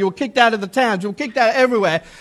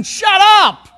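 a commanding shout, higher and louder; Jesus snapping at a demon, loud and high; sharp rebuke; 8 semitones higher, 4 dB louder than usual. Acted. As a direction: shut the spirit up in one blast. Jesus rebukes the evil spirit.

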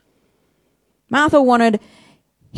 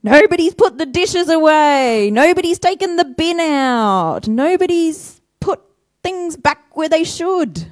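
higher, louder nagging list; higher, louder household grumble; grumbling complaints; 7 semitones higher, 4 dB louder than usual. Retold or imitated. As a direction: moan about the chores. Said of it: "I find myself complaining".

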